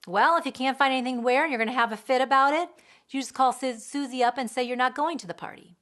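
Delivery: fast, loud, irritated; punishing. Retold or imitated. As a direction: rattle off the punishment. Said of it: "punish the feeling".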